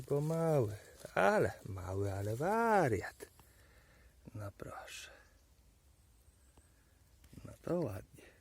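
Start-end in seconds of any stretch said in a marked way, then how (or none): 4.3-8.3 very soft whisper; unvoiced, much softer whisper. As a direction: hush it to yourself in wonder. Whispering to mushroom.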